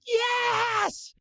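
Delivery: high, drawn-out triumphant yell; celebrating shout. Retold or imitated. Fan cheering a catch alone in hotel room, high exultant shout. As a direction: scream it like a fan at a big catch. Retold cheer at a completed pass.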